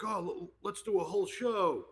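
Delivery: high, excited youngster voice; eager kids. Retold or imitated. Buddy's kids pitching a whole show, excited high voice. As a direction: rise up like a kid with a big idea.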